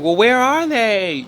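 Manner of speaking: high, slow whiny mock voice; drawn-out mocking plea; mocking whiny woman. Mocking a podcast woman, high whiny drawn question. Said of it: "she's like".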